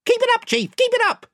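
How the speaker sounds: very high cheering-on; chorus of followers. A narrator's reading. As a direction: egg the chief on in a squeaky-high voice. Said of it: "said the other voices".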